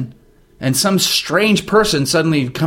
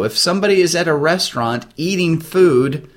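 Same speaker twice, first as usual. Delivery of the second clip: ordinary talk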